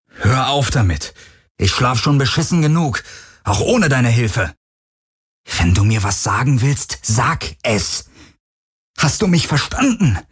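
fed-up tirade at a silent tormentor; angry demand. A narrator's reading. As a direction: demand it hotly, rising to an outraged question.

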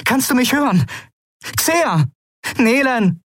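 urgent, high-pitched calls; calling out. A narrator's reading. Captain checking whether the crew can hear, urgent calling. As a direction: call each one as if across a bad link. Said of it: "rief der Kapitän".

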